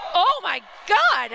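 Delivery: high, drawn-out exclamation; real amazement. Real, not acted. Commentator stunned by a shot that didn't go in, amazed. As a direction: burst out in disbelief, stretching the words. Commentator reacts to near goal.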